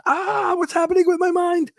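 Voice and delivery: very high, far put-on voice; mock panic. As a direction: squeak it like a baffled stranger. Mocking confused person.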